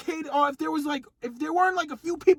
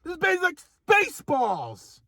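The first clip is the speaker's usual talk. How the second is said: ordinary talk, nothing marked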